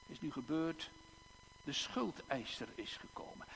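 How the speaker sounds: usual speaking voice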